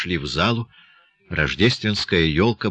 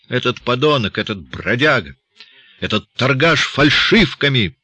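indignant list of insults, building higher; exasperated outburst; heated cursing; 6 semitones higher, 4 dB louder than usual. A narrator's reading. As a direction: curse the man, punching each insult. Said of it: "в сердцах выругался староста".

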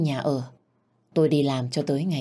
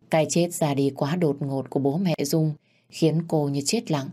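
ordinary delivery, nothing marked

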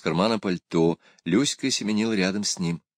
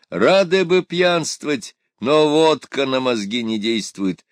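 put-on rueful drunk; 6 semitones higher, 5 dB louder, slower than usual. A narrator's reading. Drunk regrets vodka useless.

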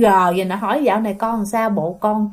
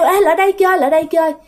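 higher-pitched mock holler; retold shouted call; 6 semitones higher, 3 dB louder, faster than usual. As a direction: imitate kids calling a playmate over. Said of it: "mới quát kêu". (giả giọng quát gọi bạn)

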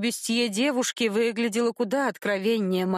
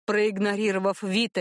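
usual speaking voice

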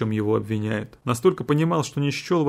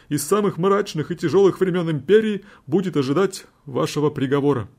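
high, solemn final plea; lawyer's closing plea; 6 semitones higher than usual. A narrator's reading. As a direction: close the defense gravely.

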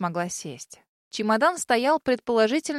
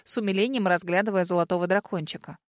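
plain reading voice

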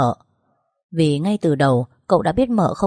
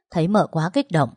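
ordinary delivery, nothing marked

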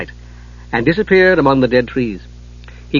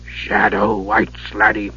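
lower, very slow ominous dwarf; low dread tones; 5 semitones lower, 3 dB quieter, much slower than usual. A narrator's reading. Dwarf warning Palin of the wights, lower and very slow. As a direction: speak the name like a curse. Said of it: "Dugan said in low, dread tones".

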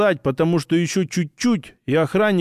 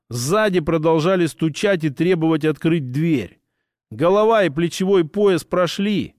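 ordinary delivery

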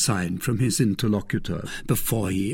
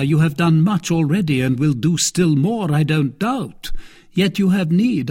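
stately cardinal, raised in pitch; cardinal, encouraging; 6 semitones higher, 5 dB louder than usual. A narrator's reading. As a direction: address a young man as his eminent patron.

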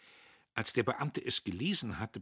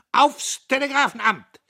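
triumphant cry; 16 dB louder than usual. Acted. Triumphant call to depart.

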